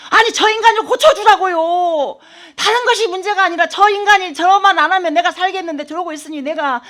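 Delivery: wife retelling her plea to God, a loud tearful yell; retold crying shout. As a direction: shout at God to fix him, as if retelling the tears. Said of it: "막 울면서 막 소리 지르고".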